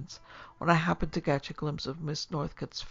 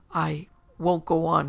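louder, slowed outcry of defeat; crying out; 2 semitones higher, 7 dB louder than usual. A narrator's reading. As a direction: call it out slowly to an audience. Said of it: "he cried".

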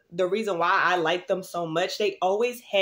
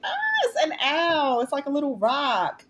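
delighted; 6 semitones higher than usual. Real, not acted.

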